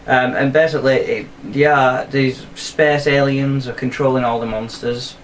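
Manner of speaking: ordinary delivery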